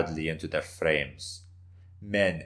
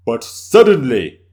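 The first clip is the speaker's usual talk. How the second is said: dramatic loud turn; 5 semitones higher, 14 dB louder than usual. Acted. He rejoined.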